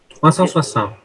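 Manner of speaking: normal talking voice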